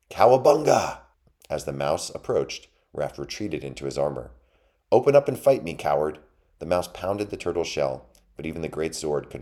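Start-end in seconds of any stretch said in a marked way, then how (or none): 0.1-1.0 high, loud warrior yell; battle cry. A narrator's reading. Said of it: "heard the battle cry". 4.9-6.2 high, loud mouse taunt; angry challenge. A narrator's reading. Mouse pounds on turtle's shell.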